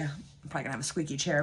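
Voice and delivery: usual speaking voice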